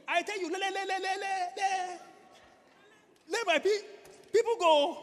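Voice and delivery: loud, high stammer on repeated syllables; stammering Moses. Acted. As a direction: get stuck on each word, then force it out loudly. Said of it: "Moses was a stammerer".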